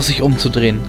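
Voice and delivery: ordinary delivery, nothing marked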